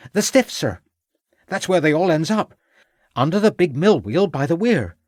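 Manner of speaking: put-on high-pitched boy, eager to tell; knowing servant lad. Boy tells the inspector about bodies, high and knowing. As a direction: insist in a high boyish voice.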